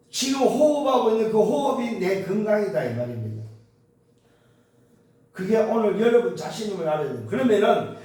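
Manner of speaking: high, loud, emphatic sermon delivery; impassioned preacher, pitched high and loud; full-force preaching. Real, not acted. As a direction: drive each point home to the congregation.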